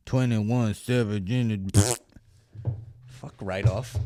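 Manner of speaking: lightly put-on sluggish rapper drone; slow, slurred mimicry of a rapper; mock mumbling rapper. Podcaster ridiculing [name], mock rapper voice.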